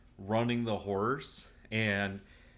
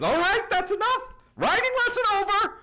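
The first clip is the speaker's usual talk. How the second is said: high, loud shout across the pasture; mother shouting the lesson is over; 8 dB louder than usual. Acted. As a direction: yell to end the riding lesson. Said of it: "begins shouting".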